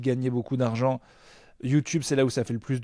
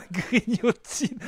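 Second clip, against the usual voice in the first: drawn-out disbelief; 7 semitones higher, much slower than usual. Pretend.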